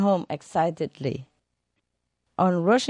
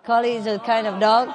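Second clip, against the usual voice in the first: laughing; 6 semitones higher, faster than usual. Real, not acted. Laughing, explaining the pun.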